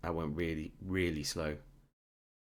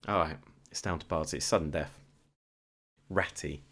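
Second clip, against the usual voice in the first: normal talking voice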